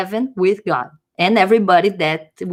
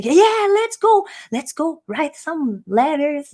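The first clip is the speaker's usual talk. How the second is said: mock excitement; 7 semitones higher, slower than usual. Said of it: "They say".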